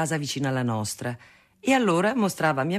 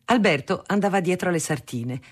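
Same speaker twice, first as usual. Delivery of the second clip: usual speaking voice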